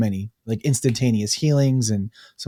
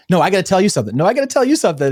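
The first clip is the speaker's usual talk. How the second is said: higher, urgent overlapping insistence; mock back-and-forth insistence; 7 semitones higher, 4 dB louder, much faster than usual. Two friends competing to share news, higher and insistent. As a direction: imitate two friends eagerly insisting over each other. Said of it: "they're going back and forth".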